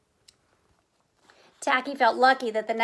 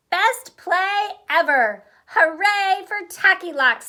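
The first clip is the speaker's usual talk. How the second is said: slow, loud, higher cheer; 8 semitones higher, 7 dB louder than usual. Acted. The class cheers for Tacky's play, loud and slow. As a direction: celebrate like happy kids. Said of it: "they cheered".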